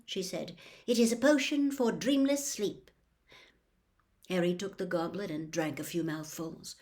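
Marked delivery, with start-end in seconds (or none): 0.8-2.9 higher brisk matron voice; brisk nurse. Acted. Nurse explaining the sleeping potion, briskly.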